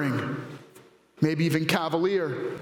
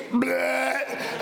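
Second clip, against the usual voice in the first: mock retching bleh; a grossed-out little noise; disgusted bleh; 6 semitones higher than usual. Dad at the mess, mock disgusted bleh.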